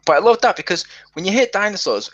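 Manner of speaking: ordinary delivery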